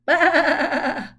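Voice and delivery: loud mock baa with a laugh; laughing sheep bleat.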